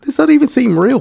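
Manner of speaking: squeaky high disbelief, this can't be real. Real, not acted. Host reacting to a wild church recording, incredulous. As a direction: pitch jumps way up in amazement.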